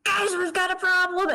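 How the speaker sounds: shout. Acted. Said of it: "whisper, shout".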